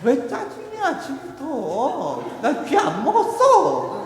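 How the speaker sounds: loud, high, drawn-out whine; irritated retort. Acted. Sleepy daughter answers screaming mother, irritated. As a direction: snap back shrilly, slowing into a whine.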